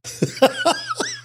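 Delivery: high, wheezy laugh. Real, not acted. Laughing at the Central America line, high laugh. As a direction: burst into a high cackle. Amused at recalling the film lines.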